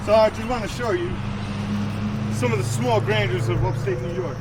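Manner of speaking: panting after the hike; winded, gasping between words; out of breath. Real, not acted. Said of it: "sorry, I'm out of breath".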